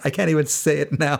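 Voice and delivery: ordinary talk, nothing marked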